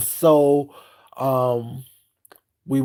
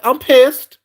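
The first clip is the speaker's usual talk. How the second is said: much louder, higher angry blurt; pissed outburst; 10 semitones higher, 11 dB louder than usual. Retold or imitated. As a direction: bark out your anger. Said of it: "I'm like".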